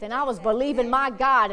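ordinary talk

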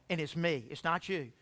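soft, low admission to God; quiet confession. Retold or imitated. Man confessing his foolishness to God while driving, softly.

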